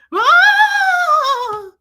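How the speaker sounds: loud, high, drawn-out howl; ghostly wail. Retold or imitated. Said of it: "She sounded like a ghost".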